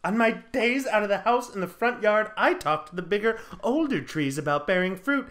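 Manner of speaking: explain, sinking at the end; mock-tragic confession; aggrieved Fern. Fern pleads her infertility.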